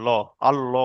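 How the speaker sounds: "ল" said full and rounded, with an o vowel; thick L sound. Shown as a demonstration. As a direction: say "ল", "আল-ল" with a heavy L and o. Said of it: "ল উচ্চারণ করতে হবে". ("ল", "আল-ল" পুর করে, ও-ধ্বনিতে ভারী উচ্চারণ)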